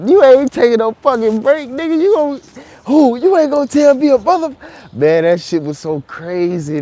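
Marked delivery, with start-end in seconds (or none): 0.1-4.6 loud, high heckler yelling; mock furious yelling. Heckler yelling at Charleston not to take a break, loud and angry. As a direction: bark it at him like an angry crowd guy.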